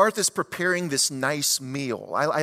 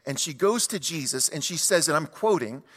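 usual speaking voice

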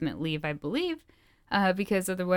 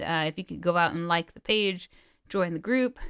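ordinary talk

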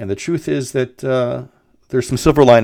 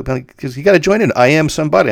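urging teacher; 7 semitones higher, 5 dB louder than usual. Retold or imitated.

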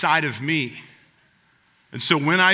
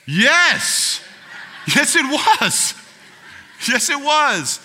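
higher, louder and slow, drawn-out gloating; smug agreement; 11 semitones higher, 6 dB louder, much slower than usual. Retold or imitated. Answering the apologizing bad driver with a smug, drawn-out yes. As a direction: savor every word like you've been proven right. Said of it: "You're like".

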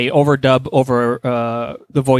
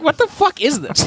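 baffled reaction; 12 semitones higher than usual. Retold or imitated. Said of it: "it's like".

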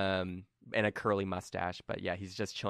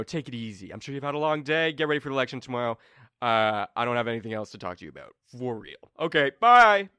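high, loud rapid brush-off; Turi panicking; 5 semitones higher, 8 dB louder than usual. Acted. Game master as Turi frantically refusing Colm's talk. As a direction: hustle him out, racing the last lines.

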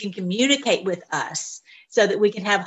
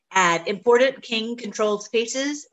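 normal talking voice

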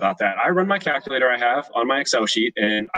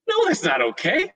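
much higher, slow scolding wife voice. Retold or imitated. Wife scolding husband over the voicemail firing, much higher. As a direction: play the wife telling him off.

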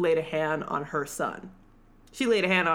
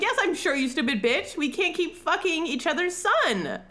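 higher, hammed-up irritated retort; mock exasperated snap; 9 semitones higher than usual. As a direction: play Roz snapping back at Lil in mock annoyance. Roz snapping at Lil.

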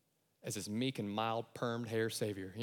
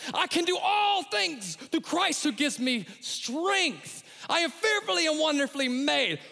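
high, loud declaration of faith; shouted scripture declaration; triumphant declaration; 7 dB louder than usual. Acted. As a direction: proclaim it like a battle cry. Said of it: "you gotta say".

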